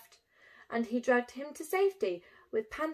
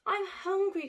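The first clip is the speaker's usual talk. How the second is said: higher, pleading cry; 7 semitones higher than usual. Acted. Cyril crying out to Bruce in hunger, mild plea. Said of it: "cried Cyril".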